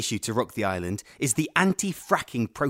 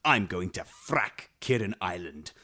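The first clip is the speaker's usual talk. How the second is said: normal talking voice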